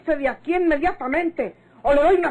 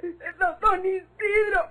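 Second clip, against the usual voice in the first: sollozos que cortan las palabras; llorando entrecortado; 3 semitones higher than usual. Acted. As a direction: lo cuenta entre lágrimas, tartamudeando. Victoria cuenta entre llantos. (Victoria llorando cuenta lo que hizo don Isidro)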